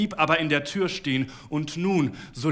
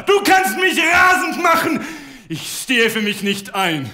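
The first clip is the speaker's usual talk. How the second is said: much higher, louder, slow menacing yell; raging shout; 12 semitones higher, 10 dB louder than usual. A narrator's reading. Husband warning his wife he can't control himself, raging shout. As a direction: lose control at full volume.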